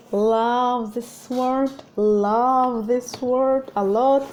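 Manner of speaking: love this word drawn out very slowly; stretched out love this word. Shown as a demonstration. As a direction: linger on every word, delighted. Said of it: "I love this word".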